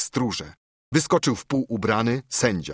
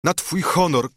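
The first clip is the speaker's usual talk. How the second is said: high, raised desperate cry; piercing cry; 8 semitones higher, 4 dB louder than usual. A narrator's reading. Telimena pleading to the count, piercing cry. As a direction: cry out piercingly, pitched well up. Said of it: "krzyknęła przeraźliwym głosem".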